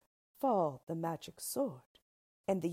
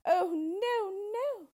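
high, loud wail of refusal; distraught, high-pitched protest; anguished cry; 13 semitones higher, 6 dB louder, much slower than usual. A narrator's reading. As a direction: cry out in horror as the princess. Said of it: "she cried".

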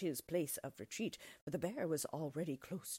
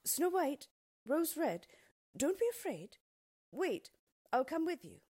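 higher, louder call into the distance; raised, carrying storybook call; calling after them; 9 semitones higher, 4 dB louder than usual. A narrator's reading. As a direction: call out to stop someone running away. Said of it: "the bear called after them".

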